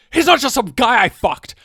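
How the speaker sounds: acted TV-drama indignation; indignant retort. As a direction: throw it back, affronted.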